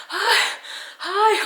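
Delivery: high, loud, breathless hi after a sprint; out of breath calling to the driver; panting greeting. Retold or imitated. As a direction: gasp it out, winded from running. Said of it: "I'm, like, panting".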